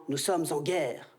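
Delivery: slow, grave presidential tone; a hint of Macron's solemn address, slowed. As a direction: deliver it like a solemn speech. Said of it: "il l'a dit, Macron".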